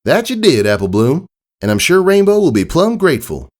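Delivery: cheerful country pony telling good news; friendly farm mare. Acted. Applejack talks to her sister.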